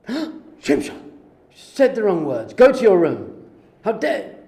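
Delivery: loud, high hammed-up parental rage; mock angry scolding. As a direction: bellow at the child in pretend fury.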